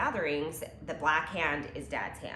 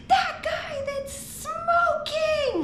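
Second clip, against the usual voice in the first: put-on high, slow child shouting out; kid voice; 6 dB louder, much slower than usual. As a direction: call it out like an excited little kid. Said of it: "and he says".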